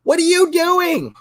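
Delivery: high, slow, shrill-sounding mock panic; whiny mock outrage. Vlogger mocking whining commenters in a high slow voice. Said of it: "whining and bitching about it and saying".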